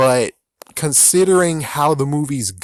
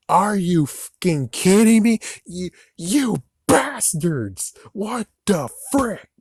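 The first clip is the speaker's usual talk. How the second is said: mock outrage; 7 semitones higher, slower than usual. Outraged fan reacting to sequel.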